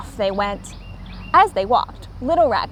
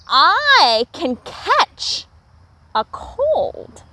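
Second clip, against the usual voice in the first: sudden idea; 5 semitones higher, much slower than usual. A narrator's reading. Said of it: "He stopped and he said".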